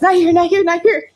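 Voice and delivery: quick pleading repeats, playing someone tuning out; mock escape to happy place. Mocking co-host shutting out bad accent, pleading. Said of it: "go to his happy place".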